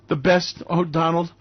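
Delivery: ordinary delivery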